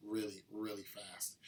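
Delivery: ordinary talk, nothing marked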